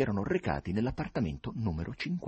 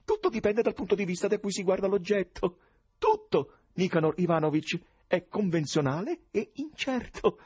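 high tenor, chattering with little laughs; cordial stranger; 10 semitones higher, 4 dB louder than usual. A narrator's reading. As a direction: gush along as an over-friendly oddball. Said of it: "esclamò lo sconosciuto con voce cordiale". (sconosciuto cordiale e ciarliero, voce tenorile)

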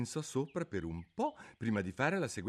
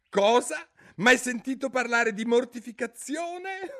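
loud, much higher cry of disbelief; astonished exclamation; 8 dB louder than usual. A narrator's reading. Griffin astonished that Alice never heard of it. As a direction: blurt it out, amazed anyone wouldn't know. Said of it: "esclamò".

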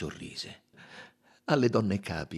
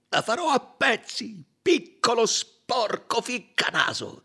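the colonel's voice, much higher, louder, menacing; threatening; 4 dB louder than usual. A narrator's reading. The colonel threatening Poirot over the crying girl, menacing. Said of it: "lanciò uno sguardo minaccioso a Poirot".

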